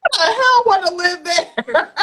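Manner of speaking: slow, laughing disbelief; amused can't-believe-it question. Real, not acted. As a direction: laugh the words out. Mocking life in Alaska.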